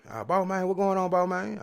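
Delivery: hammed-up reply from the store cats; store guys' reply. Store guys reply to greeting.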